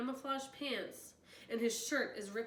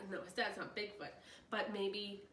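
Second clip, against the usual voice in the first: ordinary delivery, nothing marked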